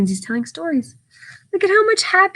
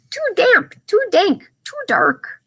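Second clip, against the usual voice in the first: tiny mouse voice, much higher; squeaky mouse; 10 semitones higher than usual. Acted. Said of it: "mouse squeaks".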